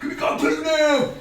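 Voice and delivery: high, loud summoning call; mock yell to come over, high and loud; mock shout. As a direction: call him over forcefully. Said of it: "And all that".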